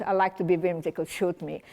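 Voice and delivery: ordinary delivery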